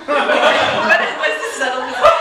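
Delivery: ordinary talk, nothing marked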